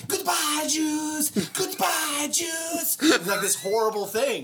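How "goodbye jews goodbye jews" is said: put-on high, bubbly little-girl voice; child actress. Imitating the bubbly child actress at her reading.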